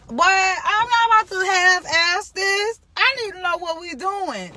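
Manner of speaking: high, loud indignant outburst; fired up, refusing to half-do it; indignant what. Real, not acted. Insists on knowing the brief.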